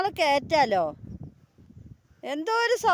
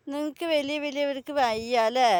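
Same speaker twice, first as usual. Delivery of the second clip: usual speaking voice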